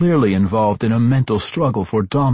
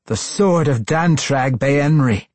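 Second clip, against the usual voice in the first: firm, higher-pitched correction; correcting; 7 semitones higher, 4 dB louder than usual. A narrator's reading. Matron mother puts Tosin right, correcting. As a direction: set him straight emphatically. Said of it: "the matron mother corrected".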